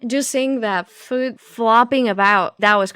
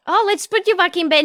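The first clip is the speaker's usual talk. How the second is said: higher, fast coaxing nurse; sweet nurse voice; 7 semitones higher, faster than usual. Retold or imitated. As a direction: coo like a caretaker. Quoting the nurse character.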